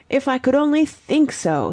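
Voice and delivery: mock-pathetic, high plaintive voice; gulping. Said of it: "with a gulp".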